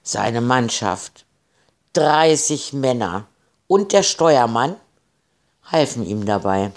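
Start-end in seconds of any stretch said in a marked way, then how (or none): none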